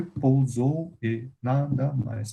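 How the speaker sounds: teacher recounts the last verse quietly and slowly. Shown as a demonstration. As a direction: count it again slowly under your breath. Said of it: "Deu sete".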